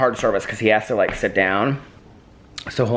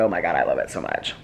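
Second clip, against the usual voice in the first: lower, softer dog voice; voicing the dog; 8 semitones lower, 5 dB quieter than usual. Owner voicing Pee Pee loving the show, in a low soft voice. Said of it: "He said".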